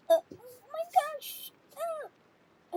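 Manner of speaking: high-pitched whimpering upset; crying. Pretend. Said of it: "she's crying right now".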